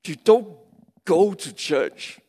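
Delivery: ordinary delivery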